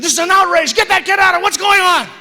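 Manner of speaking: high, loud indignant outburst; outraged crowd. Acted. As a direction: shout over the music like an angry audience. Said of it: "Everybody's going".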